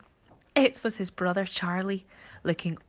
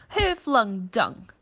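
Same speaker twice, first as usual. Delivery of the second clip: slow, louder, accusing, stressing who; accusing yell; 5 semitones higher, 7 dB louder, much slower than usual. Acted. Monkey accuses elephant and rhino, a slow accusing yell. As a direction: demand an answer from the elephant. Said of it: "Furley yelled accusingly".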